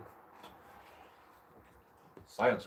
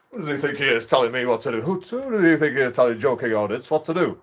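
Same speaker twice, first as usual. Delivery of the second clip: indignant rant; 5 semitones higher, 8 dB louder than usual. Real, not acted.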